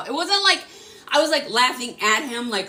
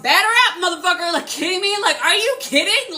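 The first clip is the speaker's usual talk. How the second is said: higher-pitched, defiant disbelief; cocky challenge; 6 semitones higher than usual. Retold or imitated. Answering the universe's test of her self-worth, defiant. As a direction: take on the challenge, incredulous.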